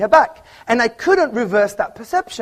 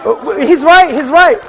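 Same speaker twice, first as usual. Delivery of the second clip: loud retort; 5 semitones higher, 7 dB louder than usual. Real, not acted. Answering a heckler in the crowd.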